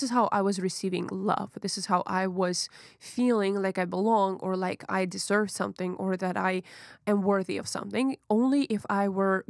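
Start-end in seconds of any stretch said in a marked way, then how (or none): none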